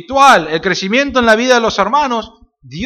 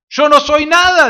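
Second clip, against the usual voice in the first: lightly voiced humble apostle, raised higher; a hint of Pablo disclaiming himself; voicing Pablo; 9 semitones higher, 4 dB louder than usual. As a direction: speak as a humble Pablo, pitched up. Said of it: "dice Pablo".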